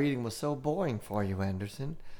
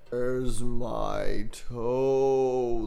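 very slow, louder drawn-out moan; groaning ghost at the bedside; 5 dB louder, much slower than usual. Acted. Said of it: "the voice groaned".

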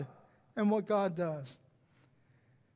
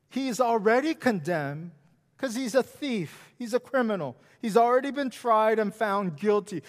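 usual speaking voice

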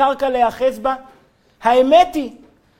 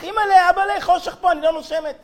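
retold panicked scream; 7 semitones higher than usual. Girl trapped in the bus luggage hold, high panicked cry. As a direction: play her trapped in the dark luggage hold, crying for her parents. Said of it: "והתחילה לצרוח".

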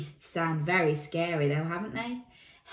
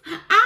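long, high, loud howl; wolf howl; 13 semitones higher, 12 dB louder than usual. Pretend. Imitates wolf howl in story.

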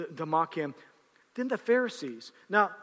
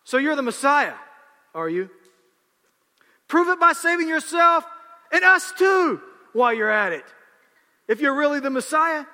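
higher, louder sneering dare; scoffing; 4 semitones higher, 5 dB louder than usual. Acted. Criminal on the cross scoffing at Jesus, sneering dare. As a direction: sneer a challenge at him.

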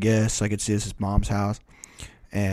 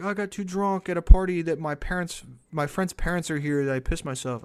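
rehearsed confession; 6 semitones higher than usual. Retold or imitated. Rehearsing excuse for the party.

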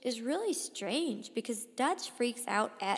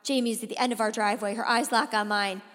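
louder, mounting breakneck storytelling; urgent narration; 4 semitones higher, 5 dB louder than usual. A narrator's reading. Boy watching Jamie stumble toward the house, urgent narration. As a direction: drive it toward a frantic climax.

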